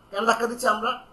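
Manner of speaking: normal talking voice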